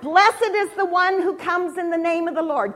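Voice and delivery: rallying proclamation. Acted. Leader feeding children the crowd's acclamation, rallying. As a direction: proclaim it with ringing emphasis.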